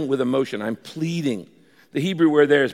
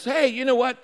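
high-pitched, casual dad opener; lightly voiced dad floating an idea; dad's suggestion; 9 semitones higher than usual. Dad to the family at dinner, lightly voiced suggestion.

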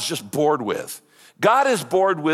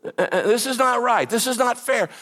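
high-pitched complaint to God; aggrieved, raised-pitch lament; 8 semitones higher than usual. Acted. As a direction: protest to God that you are wronged. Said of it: "That's called lamenting".

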